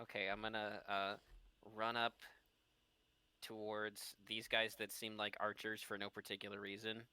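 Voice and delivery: ordinary talk, nothing marked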